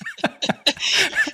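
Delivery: bright burst of laughter; high laugh. Real, not acted. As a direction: laugh at your own joke. Laughs at anecdote.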